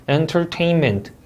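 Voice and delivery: "entertainment" slow, stress on TAIN; careful word stress. Shown as a demonstration. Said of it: "강세 유의해야 될 것들이". ("entertainment"의 TAIN에 강세)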